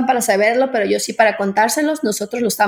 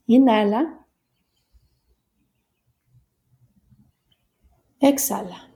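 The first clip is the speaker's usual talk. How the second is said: guided meditation. Acted. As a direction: guide the breathing calmly. Leads a breathing meditation.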